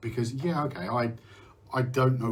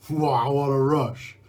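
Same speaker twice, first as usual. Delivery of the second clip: slow, loud, savoured delight; thrilled wow; 3 semitones higher, 7 dB louder, slower than usual. Pretend. Describing his own panic attacks to viewers, a slow loud thrilled wow. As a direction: relish the rush, drawing it out. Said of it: "I actually go".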